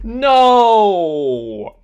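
loud, high cry of defeat; anguished no. Real, not acted. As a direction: wail it as you lose. Loses the round.

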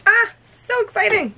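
high, loud excited exclamation; thrilled, high-voiced burst; delighted. Real, not acted. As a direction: squeal with delight. Delighted by the candles.

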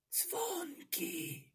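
unvoiced whisper of "Dzwonki", breathed as a secret; whispered hint. Shown as a demonstration. Teacher whispering the hint "Dzwonki" to her class. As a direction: whisper the answer so only the kids hear. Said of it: "a ja wam pomogę".

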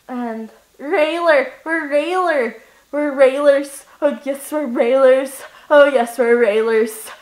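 ordinary talk

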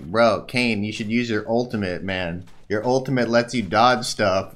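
smug know-it-all advice voice; mock the backseat gamer; mocking imitation. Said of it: "This is how you sound to other people".

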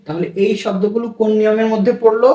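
ordinary talk

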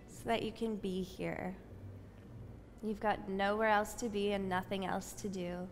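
soft, unhurried yoga teacher voice; guided breathing meditation. Acted. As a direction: ease the class into stillness with calm breath cues. Leads breathing meditation on mat.

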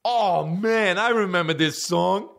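high, gushing goofy caricature; mock a friend reminiscing at every song; music buddy impression. Buddy greeting each new song, imitated high gushing. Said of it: "Every song that came on after that".

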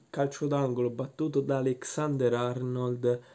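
normal talking voice

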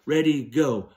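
ordinary talk, nothing marked

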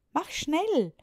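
high, loud urging; eager call. A narrator's reading. Juliane calls to her father to hurry. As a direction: hurry him along like an excited girl. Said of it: "rief Juliane".

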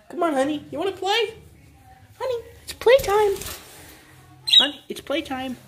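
very high, playful coaxing; sing-song squeaky call to a pet bird; high pet talk. Real, not acted.

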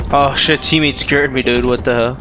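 ordinary talk

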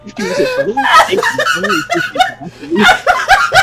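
high and laughing, drawn out. Real, not acted. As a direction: thank them through laughter. Mock gratitude laughing.

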